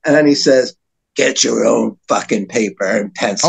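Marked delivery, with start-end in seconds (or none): 1.1-3.5 low-pitched retold threat; mock menacing rapper. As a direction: drop your voice and mock a tough warning. Retelling rapper's in-your-face threat.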